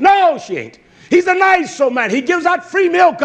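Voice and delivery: high, loud acted protest; shouted objection. Preacher acting out a plea for the old man, shouted objection. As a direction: argue it back at full preaching pitch.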